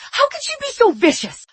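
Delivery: high outraged question; indignant mother, pitched high. Acted. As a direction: cry out at her daughter's cruelty.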